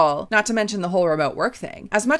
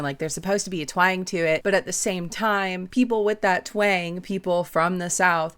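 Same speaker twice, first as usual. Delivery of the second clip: ordinary talk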